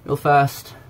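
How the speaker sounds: ordinary talk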